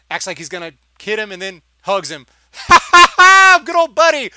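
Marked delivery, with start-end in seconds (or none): 2.7-4.3 big loud laugh into a high greeting; hammed-up, laughing old-pal welcome; mock hearty laugh. Imitating Han hugging Lando after feigned anger, mock hearty laugh.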